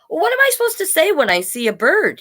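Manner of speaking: high-pitched little dog voice; puzzled dog character. A narrator's reading. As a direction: ask in a high, cartoonish dog voice. Voicing puzzled dog asking another dog.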